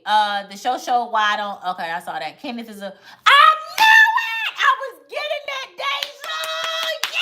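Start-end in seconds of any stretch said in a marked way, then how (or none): 3.3-7.1 very high, loud triumphant yell; excited shout. Real, not acted. Telling Deja her Virgo guess was right, triumphant shout. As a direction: scream it out, you called it. Guess about Kenneth confirmed.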